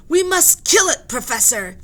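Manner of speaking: high, very loud insistence; firm order. Acted. Captain argues with the professor, high, very loud. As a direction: insist on the kill. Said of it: "said the captain".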